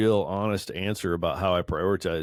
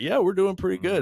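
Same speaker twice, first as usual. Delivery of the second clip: hopeful answer; 11 semitones higher, 4 dB louder, faster than usual. Retold or imitated. Hoped-for answer from the wife.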